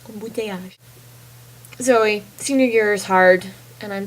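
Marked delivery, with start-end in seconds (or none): 0.1-0.8 embouteillage, softly modelled in French; French pronunciation. Shown as a demonstration. After spelling it out, embouteillage softly in French pronunciation. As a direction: say embouteillage quietly, French nasal start and soft final zh. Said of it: "the word I'm gonna teach you in French is".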